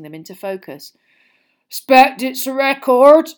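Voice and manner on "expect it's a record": put-on thick, slow, loud drunken voice; Hagrid, drunk. A narrator's reading. Drunk gamekeeper greets his visitors, thick-voiced. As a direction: slur it heavily, loud and raised. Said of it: "he said thickly".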